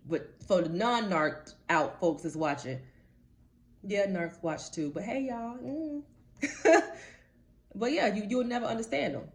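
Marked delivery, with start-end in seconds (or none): 6.4-7.1 a sudden high, loud laugh; amused laugh; burst of laughter. Real, not acted. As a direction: laugh out at the thought. Laughs at narcs watching.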